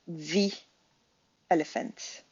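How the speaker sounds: the said as thee before elephant. Shown as a demonstration. Teacher showing the before a vowel. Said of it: "le the va se prononcer the".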